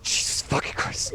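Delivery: breathless unvoiced swearing; whispered curse. Real, not acted. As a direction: whisper it in shock. Player curses in shock.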